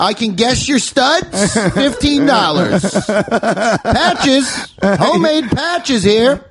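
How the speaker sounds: booth hawker. Pretend. Hawking at a punk booth.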